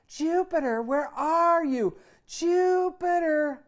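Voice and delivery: long, high, very loud operatic call of the name; mother calling her son across the street, belted at full lung; belting call. Acted. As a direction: belt it out like an opera singer. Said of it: "she keeps on yelling".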